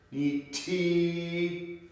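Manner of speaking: NI TI high and drawn out; with prosody. Shown as a demonstration. Teacher repeating the word for students, exaggerated prosody. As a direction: hold the TI long on a high, level note. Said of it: "really focus on the porosity".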